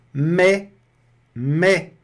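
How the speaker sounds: mé, ai said as open è, s silent; French mais. Shown as a demonstration. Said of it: "o S some, fica mé".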